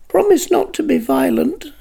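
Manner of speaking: a tiny voice from nowhere; small voice. A narrator's reading. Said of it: "A little voice in the air said".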